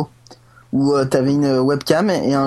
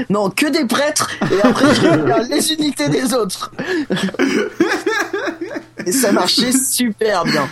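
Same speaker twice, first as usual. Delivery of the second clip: high, giggling through the words; laughing; 9 semitones higher than usual. Real, not acted. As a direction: laugh as you tell it. Laughing at his own strategy. (en riant)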